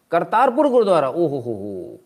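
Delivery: high, loud exclamation of amazement; loud and high at Kartarpur Gurdwara; excited exclamation. Real, not acted. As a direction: burst out with the place name. Reacting to the model photoshoot.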